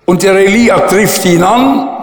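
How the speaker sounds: usual speaking voice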